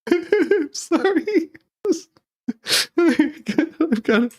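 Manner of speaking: high-pitched helpless laughter through the words; gasping out an apology mid-laugh; can barely speak for laughing. Real, not acted. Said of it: "I can't stop laughing".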